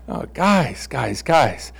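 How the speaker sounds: tired chiding murmur; weary sigh. Retold or imitated. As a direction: shake your head at them softly. Jesus shaking his head.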